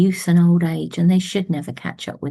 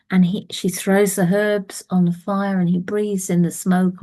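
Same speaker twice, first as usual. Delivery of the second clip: plain reading voice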